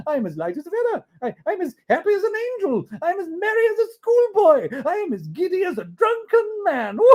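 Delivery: very high, loud, giddy glee; giddy Scrooge. Acted. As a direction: float with silly joy.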